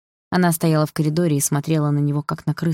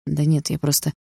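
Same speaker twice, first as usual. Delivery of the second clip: ordinary delivery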